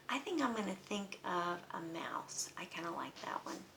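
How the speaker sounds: soft, nearly whispered; quiet musing. Real, not acted. Teacher choosing an animal for the poem, quiet musing. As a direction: think aloud under your breath. Thinking aloud before the mouse role.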